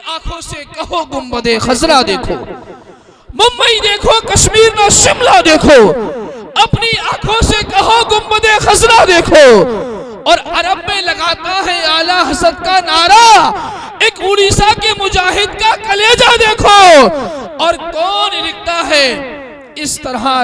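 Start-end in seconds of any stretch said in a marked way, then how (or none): 3.3-9.9 high, loud and ringing; declaimed. Shown as a demonstration. Announcer repeating the couplet loudly to the crowd. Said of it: "repeated louder". 10.3-17.1 high, loud, ringing recitation; declaimed verse. Acted. Announcer declaiming verse to the gathering, high and loud.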